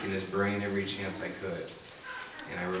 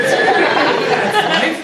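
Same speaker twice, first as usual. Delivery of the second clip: high, loud, laughing "That's life?" in disbelief; incredulous laughing echo; 17 dB louder than usual. Retold or imitated. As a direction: throw the words back, laughing in disbelief. Teen repeating it indignantly.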